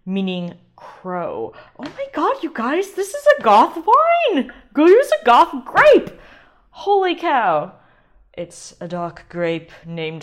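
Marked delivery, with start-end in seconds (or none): 1.8-6.1 much higher, much louder gleeful squeal; excited squeal. Real, not acted. Host thrilled that the wine is goth, excited squeal. Delight at learning crow name. 6.7-7.7 awed. Real, not acted. Awe after goth discovery.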